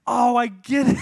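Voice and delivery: high, loud burst of getting the joke; a delighted penny-drop moment; sudden realization. Real, not acted. Host finally gets the corny pun.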